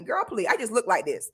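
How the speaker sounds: ordinary talk, nothing marked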